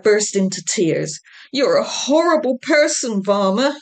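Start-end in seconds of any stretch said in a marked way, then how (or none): 1.5-3.8 sobbing. A narrator's reading. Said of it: "he sobbed".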